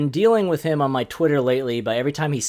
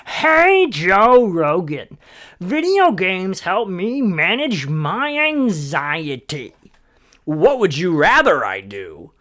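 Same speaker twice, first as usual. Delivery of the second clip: high, slow, drawn-out mock complaint; whiny gamer; 7 semitones higher, 6 dB louder, much slower than usual. Comic mimics gamer defending video games to Joe Rogan, whiny voice. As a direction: whine it loudly with stretched vowels.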